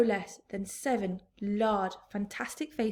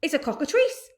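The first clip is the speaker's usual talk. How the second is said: raised alarmed yell; 7 semitones higher, 8 dB louder than usual. A narrator's reading. Police chief yelling up at the peach. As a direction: shout the warning up. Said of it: "yelled the chief of police".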